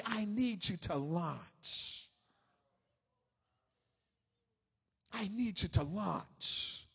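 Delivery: low, soft, very slow; hushed, deliberate summons; gentle divine call. Acted. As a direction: say it slowly and softly, weighting each word. Voices God's simple call.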